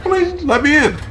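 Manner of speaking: dog's plea rising to a high whine; Marty. Retold or imitated. Dog begging owner to let him in, high pleading voice. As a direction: build from protest to high-pitched begging. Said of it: "He's gonna get ornery now".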